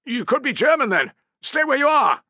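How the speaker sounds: wary command. A narrator's reading. Wary sentry orders them.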